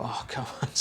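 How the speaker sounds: ordinary delivery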